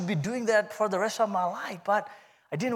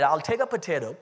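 ordinary talk

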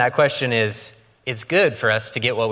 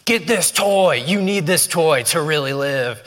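Hulk Hogan yelling; 5 semitones higher than usual. Retold or imitated. Wrestler yelling at kids to buy toys, mock hype. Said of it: "Hulk Hogan yelling at kids".

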